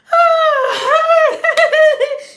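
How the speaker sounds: high laughing whoop at the end of a long laugh; laughing shout. Retold or imitated. Mocking how people finish a long laugh, high laughing whoop.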